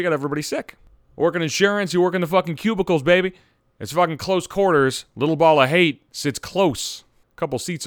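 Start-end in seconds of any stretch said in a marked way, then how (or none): none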